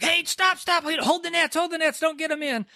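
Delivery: frantic fisherman shouting over the water; urgent shout. Acted. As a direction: yell at the crew to hold the nets before they tear. Peter sees the nets breaking.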